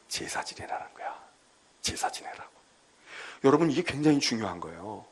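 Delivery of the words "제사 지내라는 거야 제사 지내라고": hushed whisper with stress on 제사. Acted. Preacher telling the congregation God's command, whispered. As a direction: lean in and breathe it out softly.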